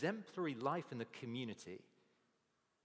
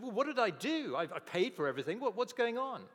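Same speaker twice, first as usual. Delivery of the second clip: much higher, louder startled protest; shocked; 10 semitones higher, 8 dB louder than usual. Retold or imitated. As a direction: blurt it out in sudden alarm. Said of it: "The criminal was really shocked".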